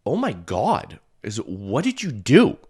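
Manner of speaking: high, slow stunned disbelief; amazed. Retold or imitated. To the woman who cleared his nose, slow amazed disbelief. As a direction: gasp it out in wonder, slowly.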